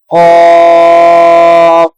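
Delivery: قاف said full and heavy, drawn out; heavy qaaf. Shown as a demonstration. Teacher models the heavy قاف for the class. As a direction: give قاف a thick, full sound. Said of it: "پر پڑھیں گے".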